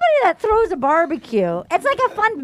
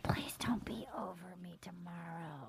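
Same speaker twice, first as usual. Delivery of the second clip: soft voiceless pleading whisper; 9 semitones lower, 25 dB quieter than usual. Retold or imitated. Host voices the bachelor whispering to his date, hushed. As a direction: whisper it as if begging close up. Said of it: "they start whispering".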